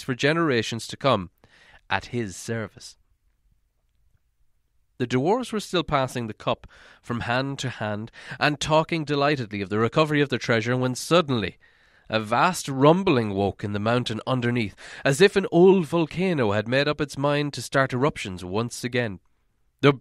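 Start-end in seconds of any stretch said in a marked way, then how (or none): none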